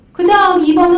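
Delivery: usual speaking voice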